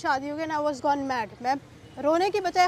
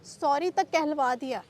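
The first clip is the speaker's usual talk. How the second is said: normal talking voice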